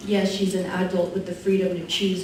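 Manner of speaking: usual speaking voice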